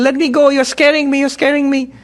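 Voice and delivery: high, frightened bleat of a lamb; young lion bleating like a sheep. A narrator's reading. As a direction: plead in a high, scared bleating voice. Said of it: "it started bleating".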